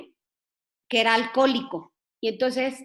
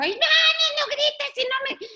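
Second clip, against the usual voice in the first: hammed-up mom screaming back over her kid; mother yelling. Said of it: "gritó más fuerte".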